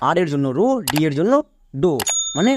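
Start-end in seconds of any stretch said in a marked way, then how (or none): none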